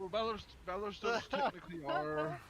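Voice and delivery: high, loud, words breaking into laughter; fighting back laughter mid-sentence; laughing while talking. Real, not acted. Cracking up mid-answer.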